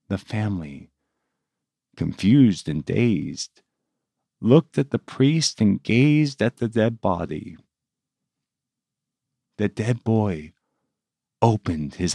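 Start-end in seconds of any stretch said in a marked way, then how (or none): none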